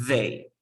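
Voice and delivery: "they" starting on a buzzing th; tongue between teeth to open "they"; voiced TH demo. Shown as a demonstration. Teacher asks student to use that sound, voiced TH demo. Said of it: "con ese sonido que has hecho".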